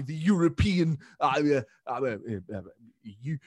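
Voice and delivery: groping stammer after a lost word; Boris. Retold or imitated. Politician falling back on the letters, halting impression. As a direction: start loud, dither down to the initials. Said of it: "can't quite lay his hand on the word".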